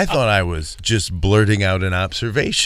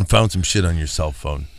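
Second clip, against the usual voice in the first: low, quiet ominous interrogator; name him like a cold interrogator; 8 semitones lower, 6 dB quieter than usual. Retold or imitated. Playing interrogator.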